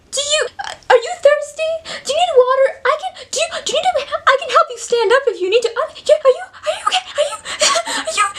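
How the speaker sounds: squeaky, frantic mock concern; hammed-up panic, tripping over offers of help; panicking other person. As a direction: fuss in a very high, breathless stammer. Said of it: "I'm the other person".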